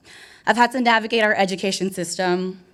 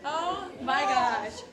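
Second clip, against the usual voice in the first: laughing; 5 semitones higher, 8 dB quieter, much slower than usual. Real, not acted. Arrives on stage, breathless.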